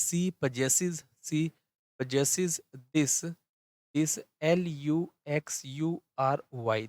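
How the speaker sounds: letter by letter, slowly; slow, measured write-along dictation; slow spelling-out. Real, not acted. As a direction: dictate while writing, spacing out each piece. Spelling out words while writing.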